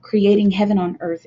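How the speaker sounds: ordinary talk, nothing marked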